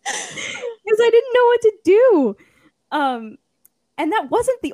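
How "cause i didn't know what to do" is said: high, loud, drawn-out whine; comically tearful, stretched-out lament; mock wail. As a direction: wail it slowly like a helpless crying kid. Mock wail retelling own crying.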